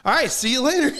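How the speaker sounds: Flounder's exit line. Retold or imitated. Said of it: "He goes".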